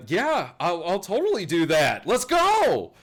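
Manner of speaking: high, loud, gung-ho cheer; eager party girl. Acted. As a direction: whoop it up, rising to a high shout. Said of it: "she says".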